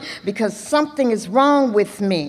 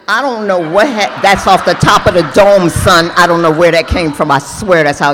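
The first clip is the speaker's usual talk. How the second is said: loud laughing outburst; 2 semitones lower, 8 dB louder than usual. Real, not acted. Laughing outburst at own line.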